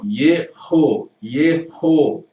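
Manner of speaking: Yeho, the h pushed out with air and loud; Yeho with breathy h. Shown as a demonstration. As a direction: say Yeho clearly aspirating the h. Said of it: "Só que ela é cultural".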